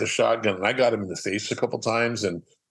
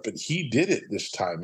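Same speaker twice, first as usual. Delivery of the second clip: ordinary talk, nothing marked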